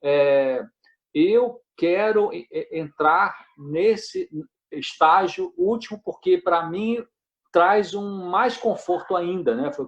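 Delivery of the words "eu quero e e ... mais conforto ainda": slow, halting, searching for each word; slow hesitant quote. Retold or imitated. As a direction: quote him slowly, haltingly, weighing every word. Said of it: "que ele falou assim". (citação lenta e hesitante)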